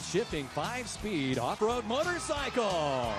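big, bright game show prize read; booming prize announcer. Acted.

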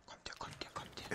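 urgent whispered call; whispering. Real, not acted. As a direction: call them over in a whisper. Sneaking in game, hushed call. (flüsternd)

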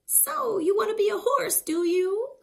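high, loud, quick cackly witch. Acted. The witch surprising the dog who wants to be a horse. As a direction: tease him like a sly little witch. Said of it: "when the witch said".

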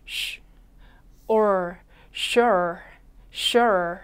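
"sure" built up sound by sound: sh, then or, then the whole word twice; 소리를 나눠 보여주는 sure. Shown as a demonstration. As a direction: break "sure" into sh and or, then blend it. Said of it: "한 번 더".

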